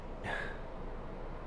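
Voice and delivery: long unvoiced exasperated sigh; breathy sigh of disbelief; heavy sigh. Real, not acted. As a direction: let out a tired, fed-up sigh. Fed up with the show.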